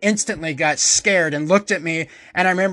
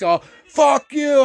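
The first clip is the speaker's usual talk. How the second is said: slow furious yell; 8 semitones higher, 5 dB louder, slower than usual. Retold or imitated. Throwing the scissors.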